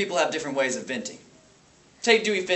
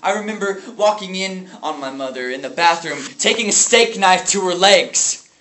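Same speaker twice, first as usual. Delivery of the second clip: loud recollection building to a higher outburst; anguished; 5 semitones higher, 8 dB louder than usual. Acted. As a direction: relive the horror with rising force. Horrifying memory of mother.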